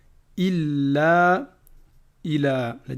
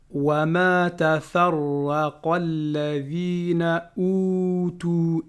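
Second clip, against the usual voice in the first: slow measured chanting of the verse; Quran recitation; much slower than usual. Acted. Teacher reciting Surah Al-Bayyinah to students, chanted. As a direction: recite each word with care.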